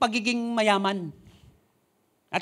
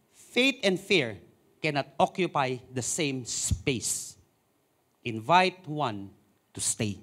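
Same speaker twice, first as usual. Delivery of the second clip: slow emphatic; 7 semitones lower, 5 dB quieter, much slower than usual. Acted. Key phrase drawn out.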